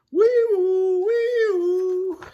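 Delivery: very high, loud wailing siren; siren imitation. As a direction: whoop like an alarm. Alarm imitated.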